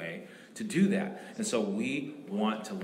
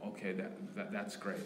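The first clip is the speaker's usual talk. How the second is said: soft approval; 2 semitones lower, 9 dB quieter than usual. Retold or imitated. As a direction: say it gently, almost to yourself. Pastor replays approving reply.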